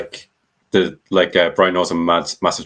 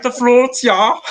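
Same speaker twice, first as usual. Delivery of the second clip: much higher, squeaky imitation of the interviewee; Teddy Herman impression; 3 dB louder, slower than usual. Mimicking the guest for the podcast hosts, squeaky high voice. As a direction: pitch way up to mimic him. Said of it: "put my voice like him".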